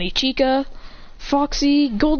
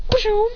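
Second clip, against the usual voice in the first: high imitated whoosh; arrival whoosh; 12 semitones higher than usual. A narrator's reading. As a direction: make a sudden warp-in noise. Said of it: "arrive going".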